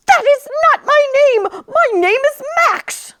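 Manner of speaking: dog firmly correcting his name; indignant dog. Acted. Dog Max corrects narrator.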